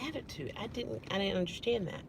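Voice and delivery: very soft, restrained reply; retold hushed confusion; quiet bafflement. Guest not understanding the manager's remark, quietly. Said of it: "I was talking quieter than this".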